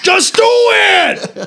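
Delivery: high, slow hammed-up yell; strained comic shout; Adam Sandler. Comic springing an impression on friends, high yell. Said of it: "that was Adam Sandler".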